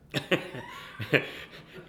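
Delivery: breathy laughter at himself; helpless chuckle at his own story; real laugh. Real, not acted.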